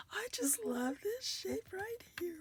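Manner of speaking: high, soft, half-whispered adoration; soft gushing. Real, not acted. Crafter loving this shape right here, high and hushed. Adoring a die shape, repeated.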